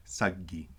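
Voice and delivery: saggi with the G held long, Eastern style; Eastern doubled G. Shown as a demonstration. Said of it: "in Eastern, it's very clear".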